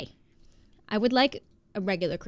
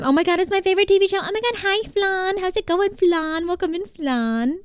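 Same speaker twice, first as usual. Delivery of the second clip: high, gushing welcome; thrilled high-pitched hello; excited greeting; 8 semitones higher than usual. Real, not acted. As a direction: rush in high, then stretch the last welcome. Excited welcome to viewer Flan.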